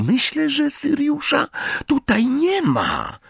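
put-on high, careful girl's voice. A narrator's reading. Hermiona's voice held.

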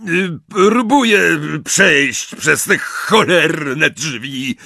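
high, loud, strained and slow curse; exasperated shout. A narrator's reading. Amisius struggles with the locked door, angry shouting. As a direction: force the words out in a furious yell. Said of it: "krzyknął Amisius".

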